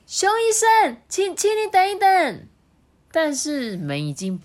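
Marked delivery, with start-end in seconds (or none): 0.1-2.5 put-on very high boy shout; calling out. A narrator's reading. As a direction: call after him urgently. Child calls doctor back. (着急大喊的小孩)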